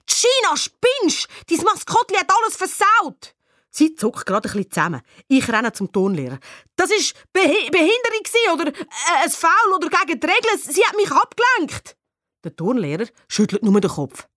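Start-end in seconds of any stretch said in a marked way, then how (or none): 0.1-3.3 very high, angry outburst; outraged high-pitched yelling; furious accusation. A narrator's reading. Yelling at teammate who spoilt shot. 6.8-12.0 high, flustered complaint; agitated appeal to the referee; indignant protest. A narrator's reading. Protesting the miss to teacher.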